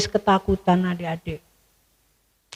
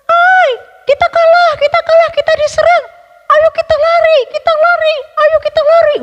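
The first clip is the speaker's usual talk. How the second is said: very high, loud panicked cry; panicked soldiers; 8 dB louder than usual. A narrator's reading. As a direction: scream to flee in terror. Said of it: "mereka takut". (prajurit panik berteriak ketakutan, suara sangat tinggi)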